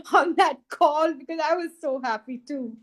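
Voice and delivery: high, laughter-tinged happy voice; laughing happily. Real, not acted. Telling of crying with the father for joy, laughing happily. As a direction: talk on through a laugh, pitched high.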